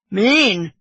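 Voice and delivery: loud mocking echo; bosun's scornful cry. A narrator's reading. Drunk bosun answering Kiawe, loud indignant cry. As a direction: throw the word back at him. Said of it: "cried the bosun".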